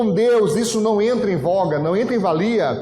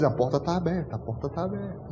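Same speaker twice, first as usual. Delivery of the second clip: fridge voice; 3 semitones lower, 8 dB quieter, faster than usual. Retold or imitated. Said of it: "ela diz".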